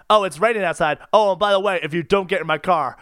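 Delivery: ordinary delivery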